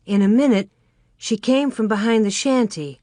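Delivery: ordinary delivery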